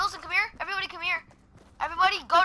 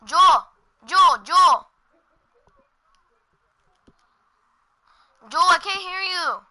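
calling out; 5 semitones higher, 9 dB louder, slower than usual. Real, not acted. Calling out to teammate.

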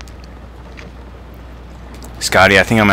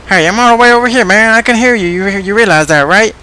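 very high, squeaky toy voice; high-pitched indignant little plush toy; squeaky Mister Snuggles; 13 semitones higher, 4 dB louder than usual. Acted.

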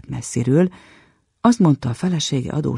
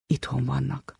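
much softer, mumbled to himself; muttered; 8 dB quieter than usual. A narrator's reading. Soldier mutters to himself at the door, very soft. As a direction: mutter it under your breath.

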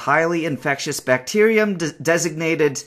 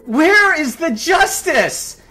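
much higher and louder, slow furious yelling; howling furious curses; anguished rage; 13 semitones higher, 11 dB louder, much slower than usual. Real, not acted. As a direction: scream at the sky in despair. Raging at injustice of rescuers' fate.

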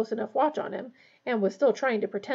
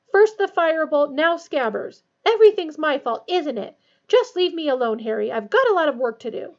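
loud, high-pitched aggrieved rant; shrill; 5 semitones higher, 8 dB louder than usual. A narrator's reading. Hermione telling Harry to leave her alone, shrill. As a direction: rant shrilly, punching each complaint. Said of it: "she said shrilly".

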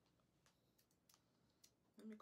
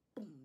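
a burst of "Boom!" louder, for the big hair; loud mock boom; 9 dB louder than usual. Real, not acted. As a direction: shout "Boom!" as the afro bursts out. Showing the afro.

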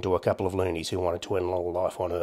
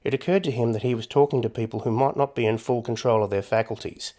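plain reading voice

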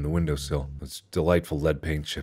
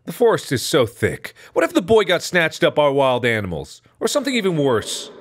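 worried scolding mom; 9 semitones higher, 3 dB louder than usual. Acted.

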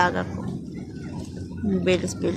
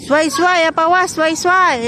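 high, loud calling out; calling from far away; 8 semitones higher, 6 dB louder than usual. Real, not acted. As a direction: call out across the park. Calling out to his pets.

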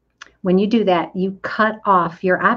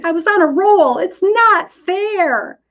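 whiny complaint; 10 semitones higher than usual. Pretend. Listener pouting that the pandemic ruined her roll, whining. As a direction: whine it like a child denied a treat.

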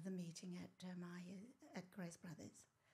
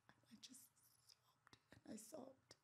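whispered grief; 4 semitones higher, 18 dB quieter than usual. Real, not acted. Recalling sobbing.

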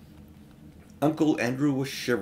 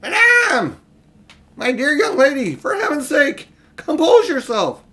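put-on much higher, loud panicky old man's plea; trembling scream; 13 semitones higher, 9 dB louder than usual. A narrator's reading. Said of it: "In a sort of trembling scream".

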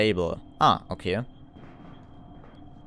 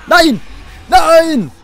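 very high shrieking no; terrified scream; 13 dB louder than usual. Real, not acted. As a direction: scream in horror. Caught by ghost.

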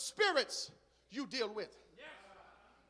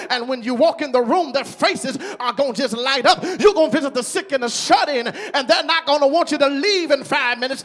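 loud, rising preacher's build; preaching at full force; 14 dB louder than usual. Acted. Preacher promising the church great ministry moments, at full force.

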